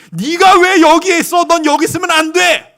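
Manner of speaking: hoger en luider uitroepen, beschuldigend; veroordelend geschreeuw. Retold or imitated. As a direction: schreeuw het als een boze menigte. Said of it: "소리 지르면서 정죄하는".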